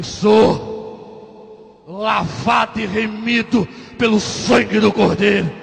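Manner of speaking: slow, full-voiced declaration of faith; proud declaration. Patient answering the big sergeant, slow proud proclamation. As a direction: take a breath and proclaim it, drawn out. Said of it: "tomei ar, falei".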